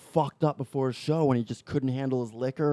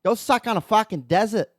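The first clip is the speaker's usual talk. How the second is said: louder, higher mock Aussie abuse; hammed-up angry Australian; Australian accent; 5 semitones higher, 4 dB louder than usual. Shown as a demonstration. Host parodying Australian cursing, broad Aussie accent. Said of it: "That's how they fucking talk".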